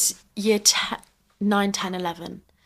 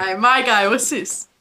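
cocky London roadman greeting; roadman accent; 3 semitones higher than usual. Pretend. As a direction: roadman slang, swaggering. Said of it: "She does a very good job. Good actor".

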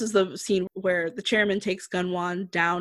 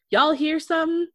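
high, louder mock voice for a deadpan chicken; unamused chicken voice; 9 semitones higher, 4 dB louder than usual. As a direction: give the chicken a sassy high voice. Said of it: "the chicken's face is like".

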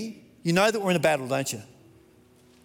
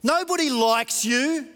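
mocking shout; 5 semitones higher than usual. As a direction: hurl the insults like the world mocking. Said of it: "So the world shouts at us".

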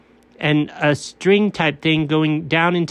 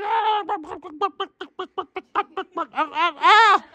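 pained ow; 11 semitones higher, much slower than usual. Acted. Rolling down the hill.

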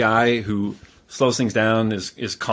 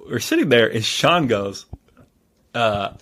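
ordinary talk, nothing marked

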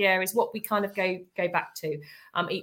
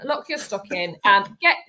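normal talking voice

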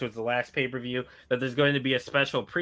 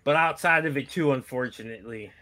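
ordinary talk, nothing marked

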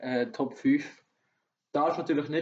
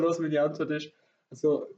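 ordinary talk

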